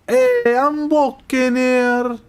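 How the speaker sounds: high, drawn-out Italian-American movie accent. Shown as a demonstration. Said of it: "it's the Italian-American accent".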